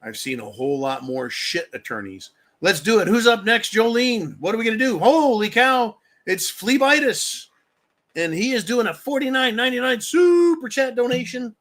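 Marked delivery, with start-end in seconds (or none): 2.6-11.6 higher, louder show-host hype; hype announcer. Acted. Host announcing a viewer's super chat donation, hyped-up announcer. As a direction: announce it big, pitched up.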